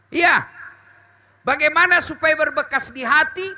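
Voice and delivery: ordinary delivery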